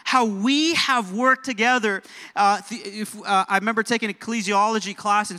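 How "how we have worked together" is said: "we" drawn out and lifted, the rest slow; stress on we. Shown as a demonstration. Preacher making church notice "we", emphatic stress. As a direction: lean hard and long on "we". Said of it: "say we".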